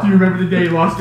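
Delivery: ordinary delivery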